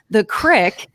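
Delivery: "crick" with a short i, hillbilly style; hillbilly pronunciation. Retold or imitated. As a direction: say "crick" to rhyme with brick, like hillbillies. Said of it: "as the hillbillies say".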